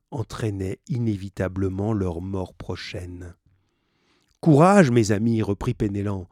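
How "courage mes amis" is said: hearten the crew forcefully; loud burst of encouragement; rallying cry. A narrator's reading.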